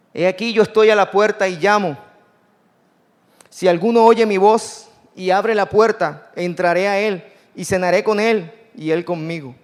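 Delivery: declaring Christ's knock at the door, preaching; full-force preaching. Acted. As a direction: call it out over the congregation. Preaching Jesus' words at full force.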